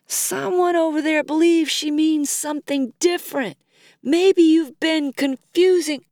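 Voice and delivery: high voice calling from the crowd. A narrator's reading. Audience member to the singer on stage, high calling out. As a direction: pitch it up toward the stage.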